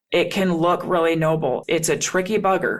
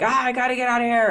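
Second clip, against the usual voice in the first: higher, rushed escape line; mock urge to bail; 6 semitones higher, much faster than usual. Avoider bailing on a hard conversation, mock urgent. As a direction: mimic someone fleeing, fast and raised. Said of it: "where we just bail, where we're like".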